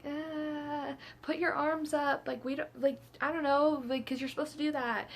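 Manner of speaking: mock helpless advice, groping for words; unsure friends. Unsure friends advising choking teller.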